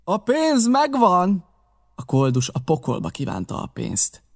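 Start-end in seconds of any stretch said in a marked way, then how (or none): 0.0-1.4 high, slow holler; drawn-out shouted reply; calling back. A narrator's reading. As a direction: call the answer down from upstairs.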